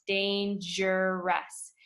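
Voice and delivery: dangerous, slowed down with a clear j in the middle; soft g sound. Shown as a demonstration. Teacher showing students the j in dangerous. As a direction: stretch out dangerous so the j sound is heard. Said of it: "you hear a j sound".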